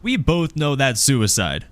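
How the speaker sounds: high-pitched objector from the crowd; woman piping up. Acted. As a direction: protest emphatically from the back. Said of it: "pipes and goes".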